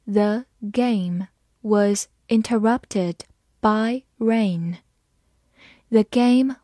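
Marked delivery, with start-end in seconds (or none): 0.0-4.8 slow, word by word; slow read-along. Shown as a demonstration. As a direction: read it slowly, separating the words for learners. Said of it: "慢慢来读". (慢慢逐词朗读)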